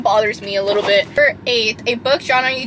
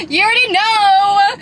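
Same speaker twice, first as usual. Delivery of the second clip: much higher and louder, bursting with excitement; excited shout; 8 semitones higher, 5 dB louder than usual. Real, not acted. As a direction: belt it out like an eager fan. Excited book hype to viewers.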